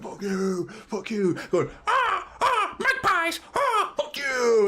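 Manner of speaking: high squealing mock taunt at the fans. Striker mockingly taunting the Newcastle fans, high strained jeer. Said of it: "He went".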